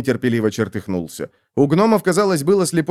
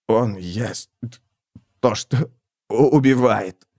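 dying gasps; slower than usual. Acted. Dying squire gasps out words.

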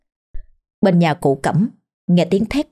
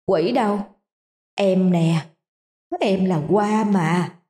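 soft, slow, eerie ghost voice; ghostly rasp; 4 dB quieter than usual. Acted. Ghost Hoa murmurs to husband Mận. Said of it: "thều thào".

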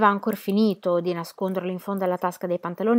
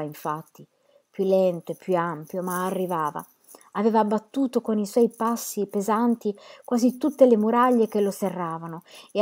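plain reading voice

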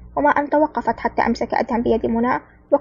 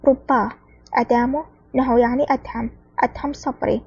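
usual speaking voice

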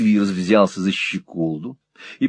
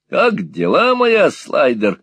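playful, higher-pitched greeting; jocular; 7 semitones higher, 5 dB louder, slower than usual. A narrator's reading. Squeers greets the old woman Peg, jocular. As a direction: hail her jokingly, drawing out the end. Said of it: "шутливо сказал мистер Сквирс".

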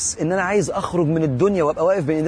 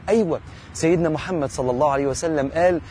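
ordinary talk

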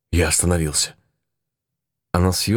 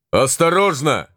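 shouted warning; 7 semitones higher, slower than usual. Acted. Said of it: "кричал Моро".

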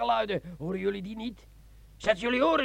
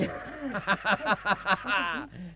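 soft, low tipsy laugh; low drunken chuckle; 5 semitones lower, 5 dB quieter than usual. Acted. (zacht dronken gegrinnik)

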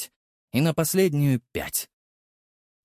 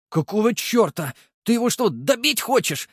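higher, outraged protest; indignant; 11 semitones higher than usual. Acted. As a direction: demand answers in disbelief. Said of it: "возмутился Прохоров".

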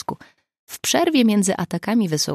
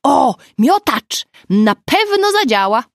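loud, high, gleeful exclamation; thrilled and high-pitched; excited shout; 8 semitones higher, 8 dB louder, slower than usual. A narrator's reading. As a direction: cheer the idea on at full voice. Delighted friend shouting into phone.

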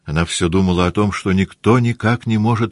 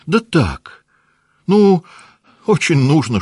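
put-on high, louder dismissive girl; playful scoff; 10 semitones higher, 4 dB louder, slower than usual. Наташа шутливо отмахивается от матери, высоко и звонко. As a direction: flick it away, high and bright.

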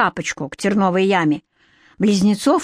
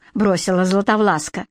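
ordinary delivery

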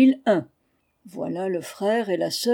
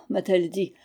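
plain reading voice

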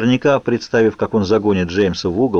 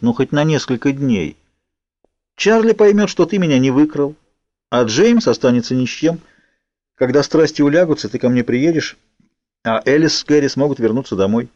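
higher-pitched, earnest persuading; persuasive; 6 semitones higher than usual. A narrator's reading. Girl bargaining with Edward for a few days, persuasive. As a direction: press the case in a raised pitch. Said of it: "звучал как можно убедительнее".

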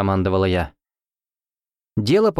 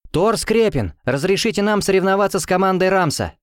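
put-on higher polite girl's request to the teacher; 8 semitones higher than usual. A narrator's reading. Student politely asks teacher to let them compete, higher voice. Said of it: "сказала я".

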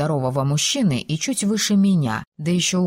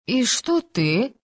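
slow, careful, high question; cautious; 7 semitones higher, much slower than usual. Acted. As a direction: ease the words out warily. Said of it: "осторожно выдавила она".

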